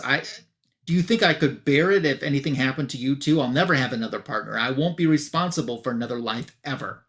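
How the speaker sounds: ordinary talk, nothing marked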